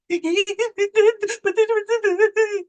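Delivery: high, hammed-up wailing sobs; mock ugly cry. Woman retelling her breakdown over stolen presents, mock ugly crying. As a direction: imitate yourself bawling, played for laughs. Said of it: "I ugly, ugly".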